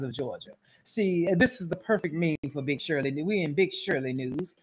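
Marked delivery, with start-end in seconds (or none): none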